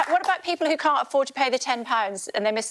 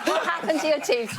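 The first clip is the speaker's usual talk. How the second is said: laughing; 3 semitones higher than usual. Real, not acted. Host repeats, still amused.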